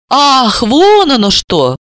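feigned realisation. Pretend. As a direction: pretend you just got it. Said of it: "сделала вид, что прозрела".

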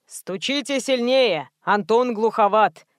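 slow, drawn-out manager's voice; warning manager. A narrator's reading. Manager advising the caller about the gatekeeper, slow warning. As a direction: warn them, taking your time. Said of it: "предупредил Куприянов".

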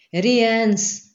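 high, loud cry for help; shouted name. A narrator's reading. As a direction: call out urgently to the wizard. Panicked shout for the wizard.

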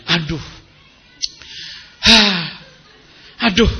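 sighing aduh, tired and fed up; mock weary sighs. Preacher mimics a wife sighing over chores, weary.

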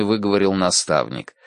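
plain reading voice

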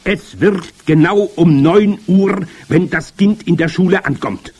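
triumphant high witch voice; triumphant call. A narrator's reading. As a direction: crow it as the grand witch. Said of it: "rief die Hoch- und Großmeisterhexe triumphierend".

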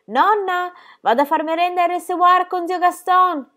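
high, loud call to the kitchen. A narrator's reading. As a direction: shout it across the house, thrilled. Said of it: "si voltò verso la cucina gridando".

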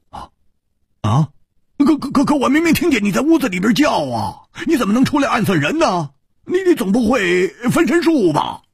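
high, fast, stammering disbelief; flabbergasted. Acted. Baffled man questioning the woman who confessed, flabbergasted. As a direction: splutter questions in a high-pitched rush.

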